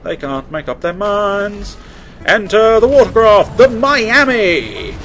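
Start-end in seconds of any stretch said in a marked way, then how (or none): 2.3-5.0 loud, high, slow grand announcement; hammed-up introduction; mock announcer. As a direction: herald the boat like a showman. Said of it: "grand announcement".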